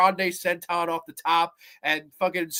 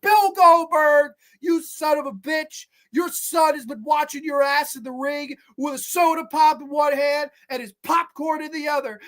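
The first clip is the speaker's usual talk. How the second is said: booming wrestler boasts; 8 semitones higher than usual. Acted. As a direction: cut a heel promo, shouting at him. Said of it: "I should cut a promo on Bill Goldberg".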